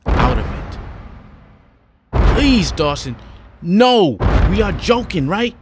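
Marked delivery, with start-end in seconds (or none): 2.3-5.6 pleading. Acted. Roland begs Dawson to stop.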